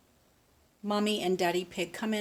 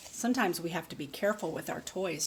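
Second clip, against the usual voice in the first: ordinary delivery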